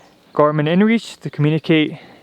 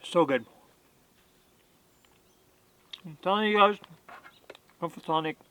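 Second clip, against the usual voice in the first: chewing while talking; 4 semitones higher, 7 dB quieter than usual. Real, not acted. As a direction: mouth full of steak. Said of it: "Sorry, bad manners. I'm eating while".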